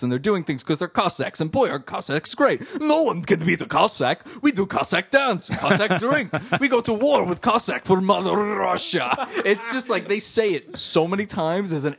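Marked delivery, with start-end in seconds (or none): 2.8-9.1 lightly hammed Russian boast; mock Russian accent. Host mocking the show's Cossack pride, in a Russian accent. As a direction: puff up a fake Russian pride. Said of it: "people are describing Cossacks".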